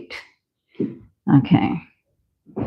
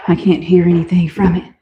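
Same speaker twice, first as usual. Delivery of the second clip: usual speaking voice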